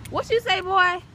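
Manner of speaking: put-on high, loud what you say boy; sassy mom challenge to her son; mock challenge.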